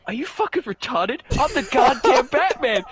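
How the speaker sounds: high, loud outburst as an acted Batman; hammed-up indignant comeback; mock angry Batman. Retelling Batman berating Robin, mock angry.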